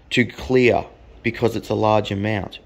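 ordinary delivery

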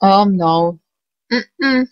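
ordinary talk, nothing marked

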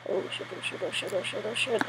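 very quiet, repeated muttered swearing; whispered panic. Real, not acted. Player about to fight the last opponent, whispered panic. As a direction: chant it under your breath in dread.

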